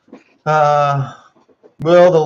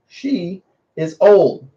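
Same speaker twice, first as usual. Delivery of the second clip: slow scolding; 5 semitones higher, much slower than usual. Real, not acted. Scolding dog, drawn out.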